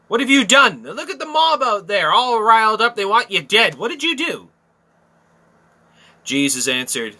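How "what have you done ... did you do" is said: alarmed Pilate. Acted. Preacher voicing Pilate's alarm.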